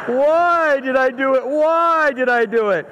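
lightly hammed-up self-reproach; remorseful child wail. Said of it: "I hear him in the room".

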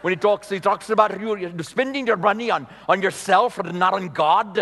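put-on rolled R's in an Indian accent, preachy; K P Yohannan's Indian accent. Pastor mimicking K P's guilt-trip sermon, Indian accent. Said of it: "That's how K P talks".

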